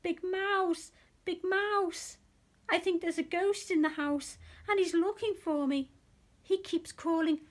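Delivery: high, loud frightened mouse; little mouse crying out in fright. A narrator's reading. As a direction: cry out as if scared of a ghost. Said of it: "feeling very frightened, he cried".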